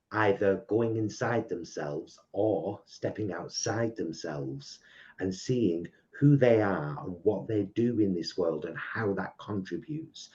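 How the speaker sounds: ordinary talk, nothing marked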